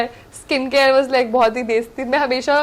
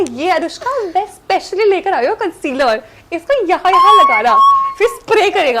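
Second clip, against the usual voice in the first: higher, louder mock makeup tutorial; imitating Rohit; 4 semitones higher, 4 dB louder than usual. Rohit eagerly teaching her his makeup, lightly imitated. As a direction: show off a concealer trick like Rohit. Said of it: "वो ऐसे दिखाता है".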